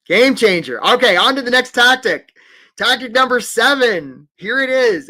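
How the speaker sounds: loud, high-energy hype; big announcement. Real, not acted. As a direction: announce it to the whole room. Loud announcement of next tactic.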